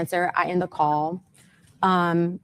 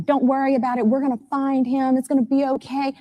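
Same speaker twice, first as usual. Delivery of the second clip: higher, sing-song imitation of empty comfort; mock reassurance; 7 semitones higher than usual. Mother mimicking Warren's "don't worry", higher and mocking. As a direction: parrot his soothing words back with scorn.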